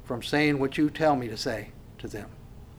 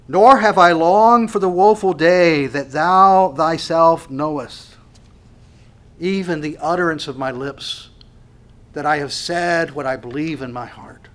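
scripture reading; 7 dB louder than usual. Acted. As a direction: read it like holy writ. Reads scripture aloud.

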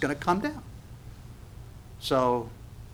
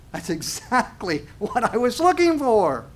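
delighted laugh; 7 semitones higher, 3 dB louder than usual. Acted. Believer delighted by signs.

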